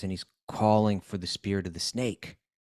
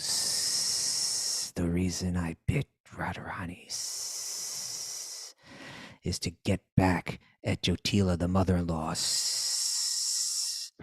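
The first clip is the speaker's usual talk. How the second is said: slow snake voice with long hisses; hissing snake; 3 semitones lower, 3 dB quieter, much slower than usual. Retold or imitated. Snake tells Krishna why it bit, slow hissing voice. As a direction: draw out the words and hiss long between them.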